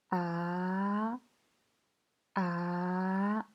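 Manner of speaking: "A" drawn out, gliding upward; second tone. Shown as a demonstration. Teacher demonstrating Mandarin tones, "A" slowly rising. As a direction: hold "A" and let the pitch climb steadily. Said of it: "c'est un ton montant".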